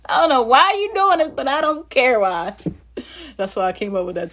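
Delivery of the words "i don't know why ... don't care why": high, loud, fast complaint; hammed-up high-pitched protest to God; exasperated believer. Believer pleading with God in the storm, high exasperated outburst. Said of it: "You be like".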